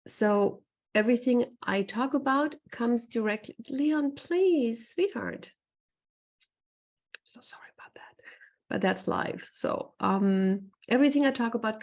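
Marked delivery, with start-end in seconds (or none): 3.7-5.5 higher-pitched coaxing plea; gentle pleading. Real, not acted. Webinar host breaks off to coax the cat Leon, pleading. 7.3-8.2 very soft, voiceless aside; whispered apology. Real, not acted. Host apologizes to webinar viewers for the cat, whispering.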